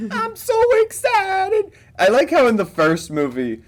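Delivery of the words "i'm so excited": very high, drawn-out mock squeal; hammed-up excitement, pitched way up. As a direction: gush slowly in a silly high voice. Acting out film character's excitement.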